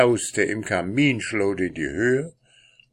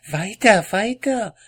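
high, pushing urgency; impatient wife; 13 semitones higher, 5 dB louder than usual. Acted. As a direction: hurry him on. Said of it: "sagte seine Frau".

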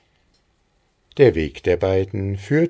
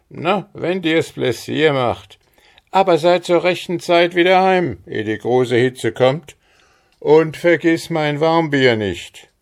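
higher, louder fatherly voice; father giving in; 10 semitones higher, 6 dB louder than usual. A narrator's reading. Father lets Maren go but warns her, higher and louder. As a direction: grant it, then add firm reminders.

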